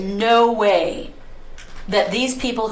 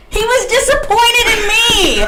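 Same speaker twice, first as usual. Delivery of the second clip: loud high indignant shout; loud high outrage; outraged shout; 12 semitones higher, 7 dB louder than usual. Real, not acted. Outraged retelling.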